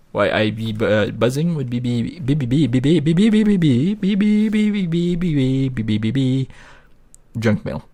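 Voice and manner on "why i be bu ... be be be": a high, buzzing "be be be" like a bumblebee. Pretend. Playing farm game, buzzing like a bumblebee. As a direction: buzz the syllables like a bee flying around. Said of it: "We, we, we turned into a bumblebee there".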